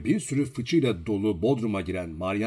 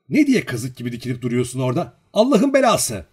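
scolding that builds to a high yell; angry shout; 3 semitones higher, 7 dB louder than usual. A narrator's reading. Girl scolding the servant in the cellar, shouting. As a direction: burst out, louder on the curse. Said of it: "diye bağırdı".

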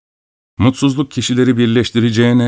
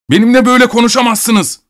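very high, raised outraged cry; indignant shout; 13 semitones higher, 4 dB louder than usual. A narrator's reading. Abogin shouting back at the insulting doctor, indignant. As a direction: shout back, affronted, pitch jumping up. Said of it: "diye bağırdı".

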